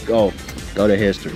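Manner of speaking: normal talking voice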